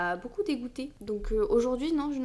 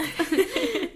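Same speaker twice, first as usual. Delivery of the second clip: petit rire plus haut; rire aigu; 10 semitones higher, 5 dB louder than usual. Real, not acted. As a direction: rire de sa propre blague. Rit de sa blague. (rire spontané, plus aigu)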